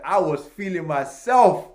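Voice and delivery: loud, drawn-out brag; hyped-up boasting. Retold or imitated. Recalling to listeners how good he felt, hyped-up boasting. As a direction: swagger through it loudly, stretching the words. Bragging about his good day.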